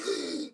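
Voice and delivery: sharp, re-enacted intake of breath; astonished gasp. Realising the nail was her wand, astonished gasp. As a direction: gasp at a sudden discovery.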